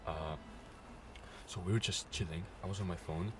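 hushed, uneasy half-whisper; nervous night vlog whisper. Real, not acted. Retelling scratching noises, hushed at night.

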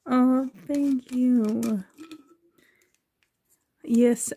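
usual speaking voice

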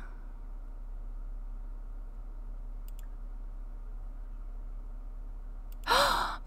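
long breathless gasp; sharp drawn-in gasp of surprise; big gasp. Real, not acted. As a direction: gasp in sudden shock. Sees the cat return.

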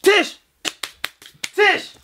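high, loud shout of a name; calling out. Acted. Man calling for his girlfriend Tish, urgent shout. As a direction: yell for someone in another room.

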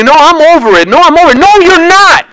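rising, high-pitched vehement protest; insistent denial. Acted. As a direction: build from insistence to a ringing contradiction. Acted denial, then forceful contradiction.

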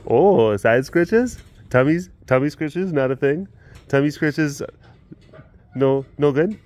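playfully checking what the cat likes; animated. Real, not acted. Asking the tiger which scratches she likes, animated.

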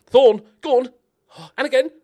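high, loud urging; urgent shouting. Real, not acted. Gamer urging Thorne towards goal, urgent shouting. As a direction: yell the striker on. Urging his player to score.

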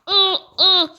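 two short high, loud exclamations; loud startled "ah" cries. Real, not acted. Exclaims while doing game task. (yüksek sesle "aa" nidası)

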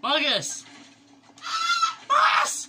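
very high, loud yell of a name; big sister yelling at a noisy little brother. Real, not acted. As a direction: shout him down, high and loud.

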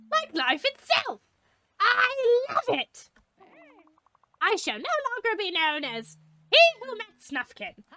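high-pitched, grand declamation; hammed-up, squeaky self-important hero; theatrical little creature. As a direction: proclaim a new identity with pomp. Voices the bird character.

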